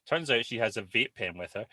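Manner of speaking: normal talking voice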